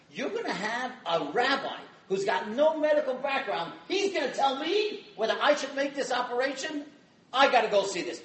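retold incredulous protest; incredulous doctor. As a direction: act out an indignant, disbelieving doctor. Acting out the sceptical doctor.

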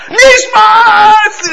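high, loud ecstatic cry; screaming prayer. Retold or imitated. Rabbi acting out chassid screaming Nishmas in shul. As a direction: cry it out to the heavens. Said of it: "He starts screaming like".

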